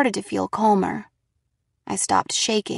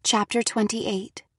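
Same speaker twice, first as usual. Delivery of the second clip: plain reading voice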